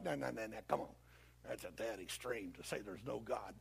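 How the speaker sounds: low, soft brush-off; dismissive believer. Acted. Believer rejecting the devil's extreme claim, low and dismissive. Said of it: "you'd be like".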